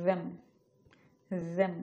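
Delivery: "them" with a buzzing voiced th; careful isolated "them". Shown as a demonstration. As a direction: model "them" short, tongue tip at upper teeth. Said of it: "দ sound দিয়ে".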